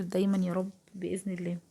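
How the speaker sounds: hushed whisper of a blessing; whispering a Ramadan blessing to viewers; whispered prayer. Real, not acted. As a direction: breathe the prayer softly.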